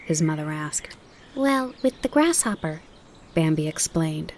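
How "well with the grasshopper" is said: put-on high eager child answer. Fawn tells mother about his new friend, eager. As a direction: explain it, full of delight.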